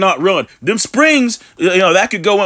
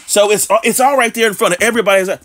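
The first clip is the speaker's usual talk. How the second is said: ordinary delivery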